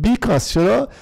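ordinary talk

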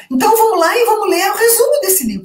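mock student voice. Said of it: "Sérgio, diz assim".

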